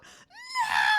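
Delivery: despairing wail. Retold or imitated. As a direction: let it rip like he's losing everything. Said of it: "like, freaking out".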